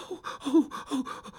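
high trembling "oh" gasps, dying away; fearful moans. Acted. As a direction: moan in fright, fading off. Regards fallen talisman fearfully.

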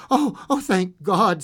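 relieved outburst. A narrator's reading. Mother crying out to the visitor in loud relief. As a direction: burst out in thankful relief.

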